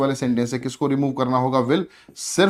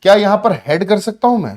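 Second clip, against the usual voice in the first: a student's unsure question, higher pitched; teacher imitating a doubtful student's question; student asking; 5 semitones higher, 7 dB louder than usual. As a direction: voice a puzzled student asking sir. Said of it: "अब mind में आएगा".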